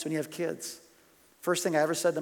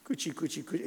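soft coochie-coo to a newborn; mock baby talk; 8 dB quieter than usual. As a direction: imitate cooing at a baby, very quietly. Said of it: "you'd say to your son something like".

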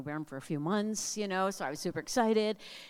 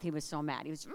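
usual speaking voice

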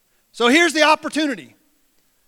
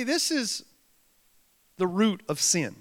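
ordinary talk, nothing marked

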